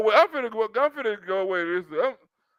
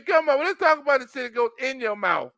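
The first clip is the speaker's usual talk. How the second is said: ordinary talk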